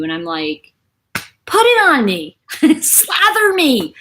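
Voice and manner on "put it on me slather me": louder, higher eager plea; eager retold plea with a laugh. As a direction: slather me, with a laugh. Eager reply to doctor.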